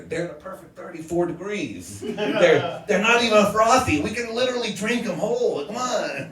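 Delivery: high-pitched, mock bashful protest; reluctant pleading. Reluctant nephew begging the guys over Coronas, pleading. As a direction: plead your way out, pitch climbing. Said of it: "real reluctant, all bashful".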